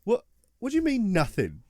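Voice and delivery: high, loud disbelief; incredulous outburst. Retold or imitated. Players to the guard who found nothing, high incredulous outburst. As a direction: demand an explanation, thrown.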